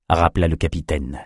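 ordinary delivery

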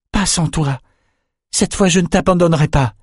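put-on young man's stubborn plea to his friend; young Bannon pleading. A narrator's reading. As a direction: hold your ground with your friend. Bannon refuses to leave Yann.

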